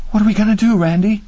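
high, plaintive woman's voice; acted anxious female voice; fearful appeal. A narrator's reading. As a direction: plead helplessly for an answer.

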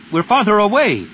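high, loud yell of protest. A narrator's reading. Pilot to crewmate in battle, yelling. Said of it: "she yelled into the targeting mask".

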